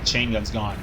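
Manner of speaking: ordinary talk, nothing marked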